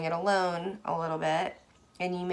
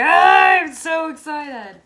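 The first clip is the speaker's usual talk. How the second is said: high loud slow shout of excitement; excited yell; 10 semitones higher, 12 dB louder, slower than usual. Acted. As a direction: yell it out thrilled. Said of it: "I'm, like, yelling".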